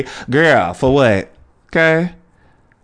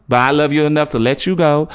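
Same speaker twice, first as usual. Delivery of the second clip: ordinary talk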